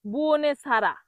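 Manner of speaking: বুওনেসারা, "e" after n and "a" after s; wrong-way demo of বুওনেসারা, vowels swapped; common mispronunciation. Shown as a demonstration. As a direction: say বুওনেসারা as "buo-ne-sa-ra". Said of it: "কি বলে?".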